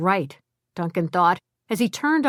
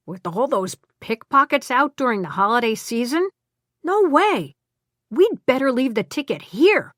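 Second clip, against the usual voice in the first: firm, higher and insistent; emphatic; 5 semitones higher than usual. A narrator's reading. Woodrow emphatically refusing to carry the ticket. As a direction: push it hard, no arguing. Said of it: "Woodrow said emphatically".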